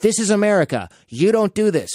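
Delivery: old Korean store owner facing a mob, a hint of her high pleading voice; imitating a pleading old woman. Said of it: "and she goes".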